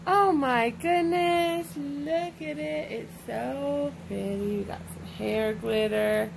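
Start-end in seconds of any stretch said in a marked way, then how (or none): 0.1-4.6 slow, drawn-out cooing; gushing admiration. Real, not acted. Parent admiring a toddler's glittery hair, gushing slowly. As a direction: coo in wonder, stretching the words.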